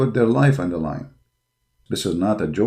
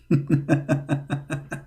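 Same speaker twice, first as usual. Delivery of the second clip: soft laugh; 4 semitones higher, 8 dB quieter than usual. Real, not acted. Laughing at his mockery.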